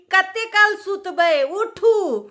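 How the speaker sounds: high, loud village-dialect scolding; scolding call. Retold or imitated. Mother-in-law loudly scolding the bride in dialect. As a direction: yell at her to get up. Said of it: "तो बोलती थी".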